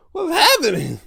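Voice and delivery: high, loud disbelief; high, stunned squeal; shocked squeal. Real, not acted. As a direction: squeal it at the screen.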